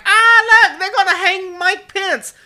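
high, loud, frantic mock panic; hammed-up scared politician squealing. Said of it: "Running around like".

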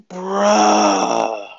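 crying bruh. Retold or imitated. Reaction to fifty pounds.

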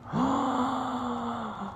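long, high theatrical gasp; mock shocked gasp. Recapper reacts to the video diary reveal, theatrical gasp. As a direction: suck in air in pretend shock.

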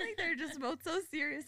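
laughing while talking, voice high. Real, not acted. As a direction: giggle through the words. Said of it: "trying not to laugh".

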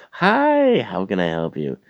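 very high, loud, long "Hi"; gushing mock customer-service greeting; fake-cheery. Manager greets difficult customer, fake-cheery. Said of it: "that bullshit fake-ass attitude".

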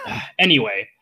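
lightly put-on narrator. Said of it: "the narrator goes".

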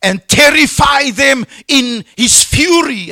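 high, loud preacher's fury; angry. Acted. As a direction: thunder it out as divine anger. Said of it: "It's God speaking in his anger".

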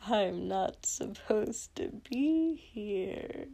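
slow, drawn-out, soft and eerie; half-asleep, unsettling murmur; eerie villain. Acted. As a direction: stretch the words out dreamily. Sleepwalking villain speaks eerily.

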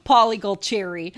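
loud hammed-up Brooklyn accent Paulie Gualtieri. Shown as a demonstration. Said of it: "I'm sure I didn't say it with a Brooklyn accent".

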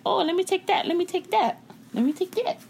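high, eager mock voice; mocking greedy copycats. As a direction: put on a high-pitched grabby imitation. Mocking people who take slang.

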